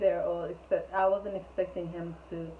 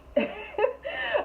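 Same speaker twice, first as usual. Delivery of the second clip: high squealing laugh. Real, not acted. Laughing at his reply.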